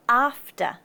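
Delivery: non-rhotic ending; schwa for final R. Shown as a demonstration. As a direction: say "after" as ahf-tuh. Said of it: "the second A is a schwa".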